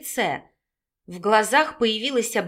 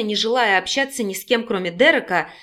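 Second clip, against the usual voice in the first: ordinary delivery, nothing marked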